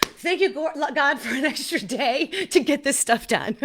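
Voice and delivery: high laughing gratitude to God; laughing thanks. Retold or imitated. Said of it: "I said".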